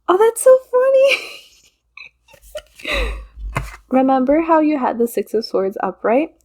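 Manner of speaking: high, loud, amused outburst; real laughing. Real, not acted. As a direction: talk through genuine giggles, pitch climbing. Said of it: "I'm sorry for laughing".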